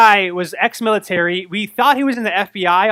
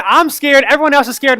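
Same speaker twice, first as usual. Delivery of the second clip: ordinary talk, nothing marked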